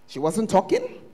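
ordinary delivery

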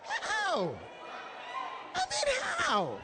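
high, slow rhetorical plea; incredulous preacher asking how; pleading how. Real, not acted. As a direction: stretch the question out in disbelief.

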